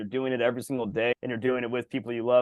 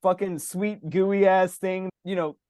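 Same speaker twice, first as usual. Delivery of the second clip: higher, slowed mock complaint about sweets; mock whine about endless sweets, in a higher voice; hammed-up overwhelmed griping; 5 semitones higher, slower than usual. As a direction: moan it out, stretching the words. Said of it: "I'm like".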